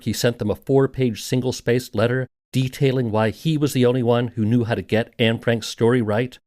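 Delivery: usual speaking voice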